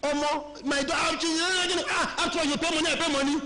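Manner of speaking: high, lightly voiced old mama pushing back; old mama retort. Old mama in the bus refusing the girl, indignant. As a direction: answer back like an offended old woman. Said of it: "Mama say".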